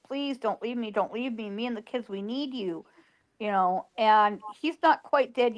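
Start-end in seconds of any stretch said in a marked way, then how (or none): 0.0-2.9 soft, hushed retold plea through tears; tearful pleading. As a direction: beg him quietly as if crying over him. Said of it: "I'm crying my eyes out".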